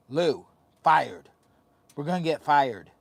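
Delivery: calling out loud to wake the dog. Real, not acted. Man loudly calling to his sleeping dog, loud call. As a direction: shout her name to rouse her.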